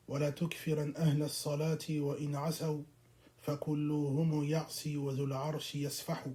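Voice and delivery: low, soft, measured Arabic recital; formal opening recitation. Real, not acted. Lecturer opens lesson with praises and reads the verse, low formal recitation.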